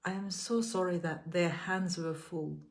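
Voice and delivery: measured, gentle affirmations with long pauses between. Acted. Leads repeat-after-me affirmations.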